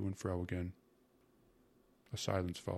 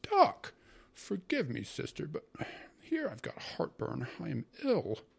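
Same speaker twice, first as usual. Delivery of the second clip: aggrieved uncle; 10 semitones higher, slower than usual. A narrator's reading. Uncle complains to his sister of heartburn, aggrieved. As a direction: burst out, then moan about your ailment.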